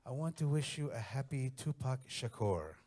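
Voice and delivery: put-on slow, careful Mexican-accented holiday wish; Mexican mother-in-law accent. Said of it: "she'd call me up, and she'd be like".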